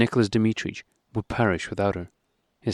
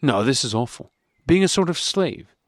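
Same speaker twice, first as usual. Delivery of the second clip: annoyed exclamation; 6 semitones higher, 3 dB louder than usual. A narrator's reading. Husband snapping at wife over her coming along, annoyed. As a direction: let the irritation finally break through. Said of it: "exclaimed Levin, unable to restrain his annoyance".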